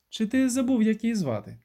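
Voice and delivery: high, pointed wifely question; needling jealousy. A narrator's reading. Jealous wife taunts husband about Irma, high and needling. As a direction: needle him, pitched well up.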